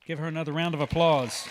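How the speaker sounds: big, fast, loud pageant-host call; loud call to the crowd. Real, not acted. Emcee calling the audience to cheer, loud. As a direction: whip the crowd up into applause.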